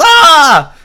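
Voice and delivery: high, loud hammed-up yelp of pain; mock scream. King being beaten by his uncle, hammed-up shriek. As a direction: let out a startled cartoon shriek.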